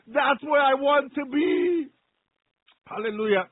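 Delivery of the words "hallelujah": usual speaking voice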